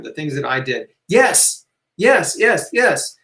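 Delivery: usual speaking voice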